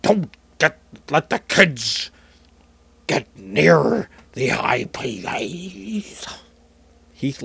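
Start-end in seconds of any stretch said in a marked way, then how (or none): 0.0-6.2 Richard Nixon impression. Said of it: "I'm going fully Richard Nixon here".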